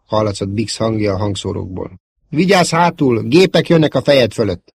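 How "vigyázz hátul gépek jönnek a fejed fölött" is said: high, loud alarm falling slightly; urgent warning. Acted. Pilot warning of fighters overhead, urgent. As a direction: alert a friend to incoming fighters.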